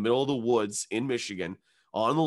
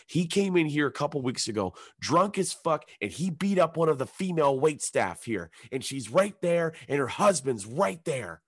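high-pitched tirade, climbing higher at the end; indignant accusation; 7 semitones higher than usual. Retold or imitated. Angry local confronting the man in the shirt, indignant. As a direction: lay out the charge with rising outrage.

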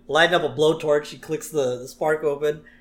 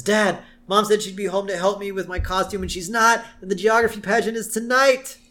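1 Lisa in a panic; 6 semitones higher, 3 dB louder than usual. Retold or imitated. Lisa panicking about her costume.

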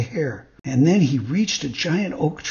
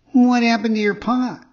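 high, loud little puppet voice; puppet asking; 9 semitones higher, 5 dB louder than usual. A narrator's reading. Puppet asks the cat about his paw, high puppet voice. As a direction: ask as the marionette, pitched well up.

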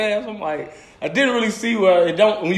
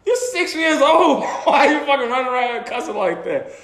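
disbelieving scold; 6 semitones higher than usual. Retold or imitated. Scolding a cussing kid.

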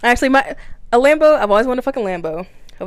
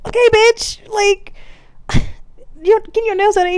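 much higher, louder sassy clapback; sassy comeback; 13 semitones higher, 3 dB louder than usual. Pretend. Talking back to imagined judges, high sassy retort. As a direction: snap back with sass, pitched way up.

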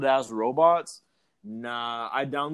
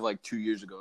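ordinary talk

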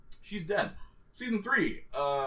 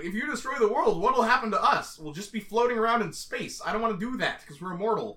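higher-pitched reasoning plea, retold; mock-worried protest in a higher voice; imitating Spike; 7 semitones higher than usual. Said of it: "it's like".

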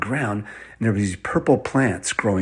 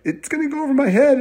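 put-on high, fast kid squeal; frightened little boy, rushed; panicking child; 4 dB louder, faster than usual. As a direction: blurt it out in high-pitched mock panic. Colin fears the rising water.

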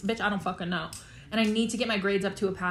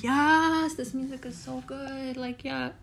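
high drawn-out yes, fading to soft; acted bliss; 4 semitones higher, 4 dB quieter, slower than usual. Herself on a walk loving the music, acted bliss. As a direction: act out delight, trailing into softness. Acts out enjoying music on walks.

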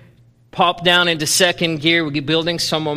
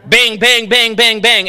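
loud, high banging noises; imitated cymbal bangs; 7 semitones higher, 7 dB louder than usual. Preacher imitating a kid crashing cymbals, loud banging sounds. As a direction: hammer out each bang like a crash.

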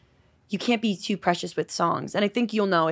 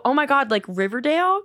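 higher-pitched eager question, re-enacted; retold excitement; 6 semitones higher, 4 dB louder than usual. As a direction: act out your starry-eyed hopeful reaction. Excitedly reacting to TV suggestion.

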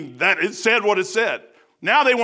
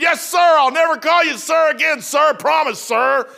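nervous shouted sirs; 7 semitones higher, 5 dB louder than usual. Retold or imitated. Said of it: "I said".

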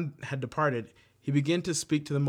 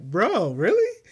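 incredulous; 7 semitones higher, 7 dB louder, slower than usual. Retold or imitated. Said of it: "It's like".

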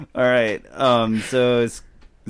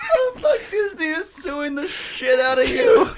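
laughing shriek. Real, not acted. Shocked at a dirty joke.

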